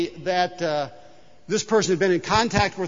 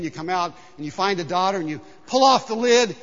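ordinary delivery

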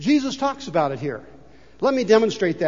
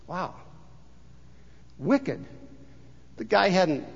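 ordinary talk

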